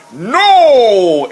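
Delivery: a loud, high, blunt "No!"; barbarian shout. Retold or imitated. [name] voicing Thundar refusing Ariel's plan, loud shout. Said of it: "Thundar just looks at her and goes".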